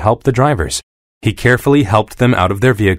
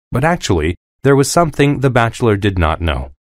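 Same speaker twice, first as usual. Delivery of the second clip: plain reading voice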